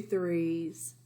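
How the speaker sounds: plain reading voice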